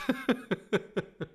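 a short high laugh at herself; embarrassed laugh. Real, not acted. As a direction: laugh off the slip. Laughs at own stumbling. (verlegen lachje)

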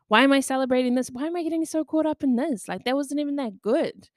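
high, hurried self-reproach; self-critical nagging. Acted. Inner voice refusing to celebrate success, nagging self-criticism.

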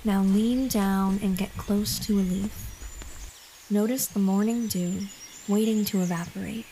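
usual speaking voice

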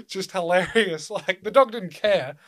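normal talking voice

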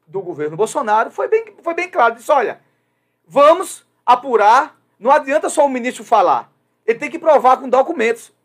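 impassioned. Real, not acted. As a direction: push it hard like a soapbox plea. Defende apuração com veemência. (comentário veemente, alto e agudo)

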